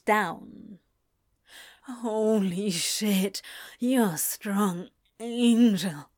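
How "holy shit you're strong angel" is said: slow, drawn-out moan. A narrator's reading. Crowley to Angel, awed by his strength, moaning. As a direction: moan the words out slowly. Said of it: "he moaned".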